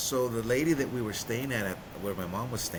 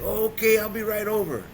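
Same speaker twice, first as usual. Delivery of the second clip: imitated loaded friend; 10 semitones higher, 6 dB louder than usual. Loaded friend promises to come over, overexcited. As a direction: mimic a buddy who is already high. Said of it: "This dude is already loaded".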